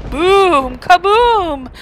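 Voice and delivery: loud high thunder crash; booming thunder. A narrator's reading. Thunder sound read aloud.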